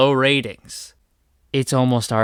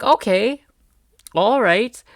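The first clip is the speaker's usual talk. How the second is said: mock acceptance; 9 semitones higher, 3 dB louder, slower than usual. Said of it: "And it's like".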